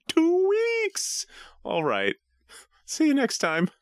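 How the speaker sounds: very high-pitched, dim-witted falsetto voice; Brooks, dopey impression. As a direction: put on a stupid, squeaky caricature of a co-host. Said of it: "Read this in your best Brooks voice. Hint. Try to sound stupid".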